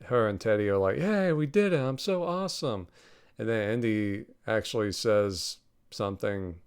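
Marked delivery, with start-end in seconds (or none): 1.0-2.9 high-pitched mock cheer; mock celebration. Helena and Teddy cheering as they escape on the ship, mock excited. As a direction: imitate gleeful, self-congratulating excitement. Acted quote of celebrating characters.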